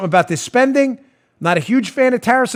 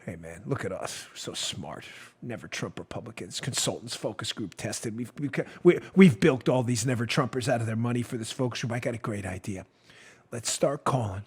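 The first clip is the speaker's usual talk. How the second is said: smug consultants; 9 semitones lower, 12 dB quieter than usual. Retold or imitated. Mocking never Trump consultants.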